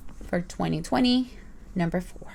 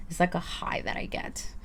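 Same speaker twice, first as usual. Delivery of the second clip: soft confessional whisper; 2 semitones lower, 7 dB quieter than usual. Real, not acted. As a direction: whisper it like a secret. Confessing her shopping habit.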